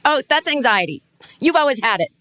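breezily matter-of-fact, acted out; blunt dismissal. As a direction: brush it off as old news. Acts out blunt talk with parents.

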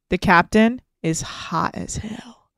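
slow, hushed whisper for juicy gossip; conspiratorial whisper. Acted. Telling her co-host a juicy secret, slow whisper. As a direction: lean in and whisper the secret slowly.

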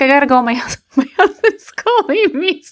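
high voice breaking into laughter; giggling through a retold excuse; laughing fake excuse. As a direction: act out a flimsy excuse and crack up.

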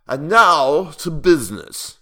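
high, loud, drawn-out pronouncement; slow, booming call to order; fat prime minister. A narrator's reading. Fat minister calling the court to business, loud and slow. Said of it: "said the prime minister, a very fat man".

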